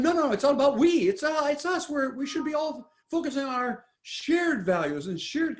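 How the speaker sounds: lightly voiced community-minded rebuttal; earnest objector. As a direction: push back with mock conviction. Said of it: "and the people said".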